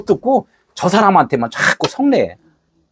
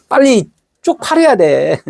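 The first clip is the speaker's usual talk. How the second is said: laughing; 8 semitones higher, slower than usual. Real, not acted. Preacher laughing mid-sermon.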